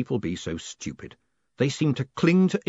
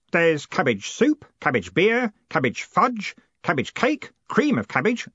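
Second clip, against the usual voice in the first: high-voiced list, item by item; eager Stanley, enthusing; 8 semitones higher, 3 dB louder than usual. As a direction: reel off each treat with keen delight. Stanley reels off cabbage dishes.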